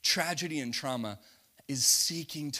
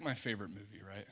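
ordinary talk

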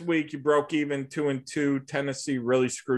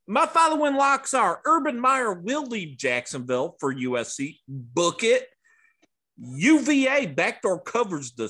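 mock Southern accent, pitched up; Bobcat impression; 5 semitones higher than usual. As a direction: ham up a Southern good-old-boy accent. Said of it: "most piss poor Southern accent I ever heard".

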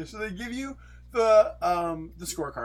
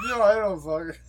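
mock drunk whine, louder; mocking a drunk friend's supercut, whiny and loud; imitating drunk Spencer; 6 dB louder than usual. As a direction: slur it out like a drunk friend, whining. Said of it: "A drunk Spencer supercut ... I'm just like".

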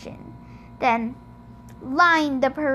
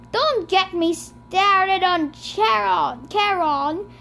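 yelling; 6 semitones higher, 3 dB louder, slower than usual. A narrator's reading. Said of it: "Hades yelled".

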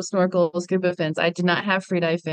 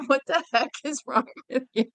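amused self-scolding, higher; mock exasperation; 6 semitones higher than usual. She scolds herself after fleeing the turtles, in amused mock exasperation. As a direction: scold yourself with a laugh behind it. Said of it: "I was like".

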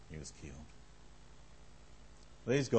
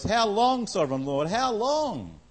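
high pleading cry to God; 8 semitones higher, slower than usual. A narrator's reading. Souls under the altar cry to God, high pleading. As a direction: lift the voice on each how long, pleading. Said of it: "They called out in a loud voice".

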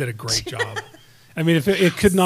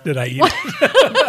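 slow, laughing, mocking repeat; mocking echo; 9 semitones higher, 6 dB louder, much slower than usual. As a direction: drag the question out while laughing. Echoing her question back, laughing.

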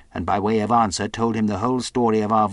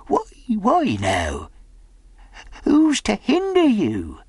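slow, high sly castaway; startled slyness; 12 semitones higher, 3 dB louder, slower than usual. A narrator's reading. Castaway asks who would hinder the boy, slyly. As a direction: probe slowly in a high character voice. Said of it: "a kind of startled slyness".

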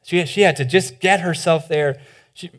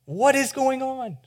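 much higher, slow and thin, aching question; anguished cry to God; 7 semitones higher, 7 dB quieter, slower than usual. Acted. Preacher voicing his own Psalm 89 doubt, high and aching. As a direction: cry out upward, lost and searching. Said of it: "I'm like".

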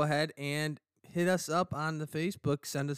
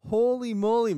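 amazed; 9 semitones higher, 10 dB louder than usual. Real, not acted. Amazed by the teaser.

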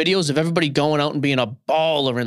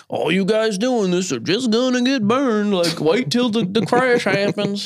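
mocking Southern drawl, drawn out and higher pitched; Texas accent; 4 semitones higher, slower than usual. Mocking the Instagram Live critics, exaggerated Texas accent. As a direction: imitate online naysayers in a put-on Texas accent. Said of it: "when you were gonna do a Texas accent".

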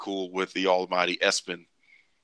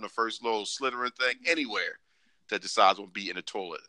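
ordinary delivery

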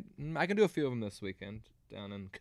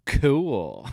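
dry dismissive; 7 dB louder than usual. Retold or imitated. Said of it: "he goes".

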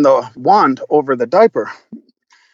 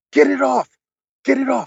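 imitated panic; 9 semitones higher than usual. Man retelling his partner's panic, high mock-panicked voice. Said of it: "goes into a full panic".

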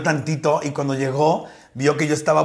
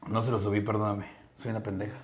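very soft, low self-reproach; mock-contrite aside; 9 semitones lower, 15 dB quieter than usual. Host mock-scolding herself to the side, hushed and low. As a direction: play at a hushed, sheepish confession to yourself.

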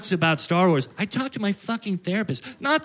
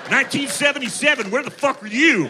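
put-on screeching old-timer laughing at his own fury; crazy old man rant; 8 semitones higher than usual. Crazy old neighbor yelling.

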